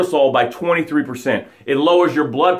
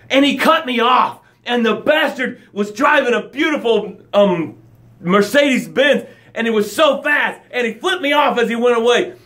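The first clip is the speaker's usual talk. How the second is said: high, heated road-rage rant; worked-up complaint, pitched high; passionate complaining; 6 semitones higher than usual. Shown as a demonstration. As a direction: vent about the driver with full passion. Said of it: "You say it with all this passion".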